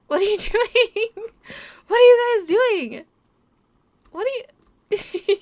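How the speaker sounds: high, loud playful scolding; high, loud amused exclaiming; excited. Real, not acted. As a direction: call out to the silly puppies. Talking to silly puppies.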